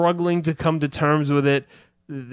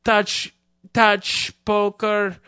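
higher, halting fake accent; fake Eastern European; 6 semitones higher, slower than usual. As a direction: stumble over words like a foreigner. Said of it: "pretend to be Eastern European".

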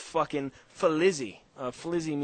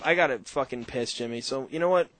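normal talking voice

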